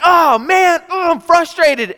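hammed-up kid frustration after a bad shot; frustrated. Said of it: "I'm mad".

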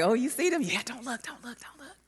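urgent whisper to a friend; hushed whisper. Retold or imitated. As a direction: whisper it so the boys can't hear. Girls whispering as boys approach.

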